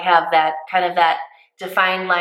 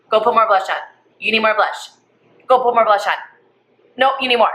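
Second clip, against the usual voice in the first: bossy nagging; 4 semitones higher, 3 dB louder, faster than usual. Retold or imitated. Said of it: "I say this to everyone".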